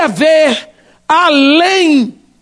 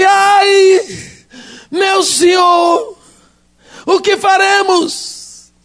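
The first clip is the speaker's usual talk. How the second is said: terrified cry; 6 semitones higher, much slower than usual. Acted. Servant Geazi panics to his master, terrified and crying out. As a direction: wail it out like a frightened servant. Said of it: "apavorado, disse".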